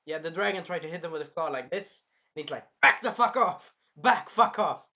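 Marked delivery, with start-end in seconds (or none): 2.8-4.8 loud, high imitated rage; pissed-off yell. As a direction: yell it to drive a beast back. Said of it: "He was, like, pissed".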